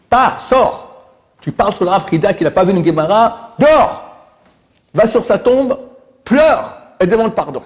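high, sharp scolding shout; angry rebuke. Retold or imitated. Rabbi rebukes the student.

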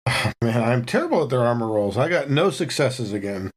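normal talking voice

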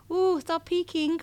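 high, slow, drawn-out mimicked coo; mock coy squeal. Imitating someone who says the creepy phrase, mock coy squeal. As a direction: draw it out high, like a coy tease.

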